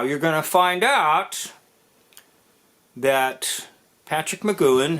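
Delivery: ordinary delivery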